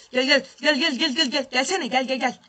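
tense excited chant; urgent calling. Real, not acted. Urging a player forward.

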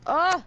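high, loud startled yell. Real, not acted. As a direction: cry out as you die. Getting killed in game.

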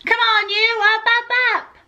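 high, insistent wake-up call; yelling. A narrator's reading. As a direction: chivvy sleepers up in a high voice. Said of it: "she yelled".

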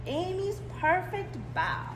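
usual speaking voice

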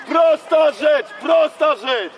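slow, loud frustrated shout across the pitch; real coach's shout. Real, not acted. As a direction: yell it at the player, stretching the words. Coach shouting at player Kuba. (wolny, głośny okrzyk trenera)

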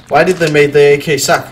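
ordinary talk, nothing marked

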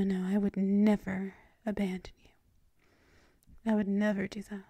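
ordinary talk

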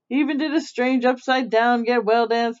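plain reading voice

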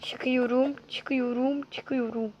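a chant trailing off to almost nothing; fading murmur. Real, not acted. Repeating while climbing, fading.

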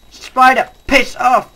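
angry yelling; angry shout. Real, not acted. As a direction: yell at the spider to go away. Spider attacking him.

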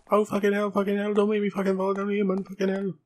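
high, panicky put-on cursing voice; imagined game character's voice. Player voicing the on-screen character in panic, high put-on voice. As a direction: squeal the swearing as the imagined little character. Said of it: "it's just how I imagine him speaking".

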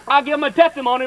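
"I give him a testimony" tumbling out fast; rushed testimony. Shown as a demonstration. Telling the congregation his first testimony, rushed out. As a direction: blurt it all at once like a happy new believer. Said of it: "in a rush like that".

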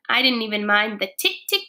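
ordinary delivery

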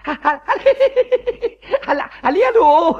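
high laugh breaking into hello; flustered happy laugh; excited laughing. Acted. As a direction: giggle with nervous joy. Excited someone finally answers.

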